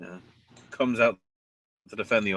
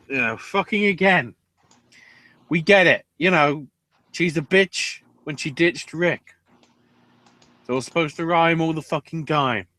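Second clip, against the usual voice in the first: higher and louder, fed up with the rerun plot; exasperated rant; 7 semitones higher, 8 dB louder than usual. Real, not acted. Fan venting to his co-host about a rehashed feud, exasperated and loud.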